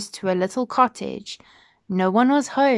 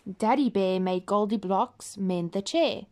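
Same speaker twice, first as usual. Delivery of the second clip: plain reading voice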